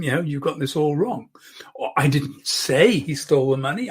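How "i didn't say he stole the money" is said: "say" stretched and lifted above the rest; stress on SAY. Shown as a demonstration. Coach hinting it may have been implied, stress on "say". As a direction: lean hard on "say", leaving doubt. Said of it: "I may have implied it".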